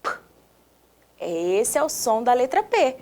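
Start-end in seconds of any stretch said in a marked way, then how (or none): 0.0-0.1 "P" as a short voiceless puff; isolated plosive "P"; sound of letter P. Shown as a demonstration. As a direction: pop the lips for "P", no vowel. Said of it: "Vamos fazer o sonzinho da letra P?".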